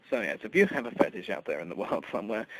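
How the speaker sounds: ordinary delivery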